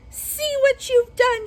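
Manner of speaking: high, loud, slow panicked screech; witch screaming. Acted. Melting witch screams at Dorothy, high and shrill in panic. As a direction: shriek it as the witch starts to melt. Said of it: "she screamed".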